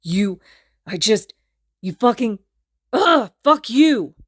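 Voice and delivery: loud sputtering building to a slow high yell; seething. A narrator's reading. Kacchan seethes at Izuku, lost for words, furious. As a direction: choke on the rage then explode. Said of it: "struggling to find his words as he seethes".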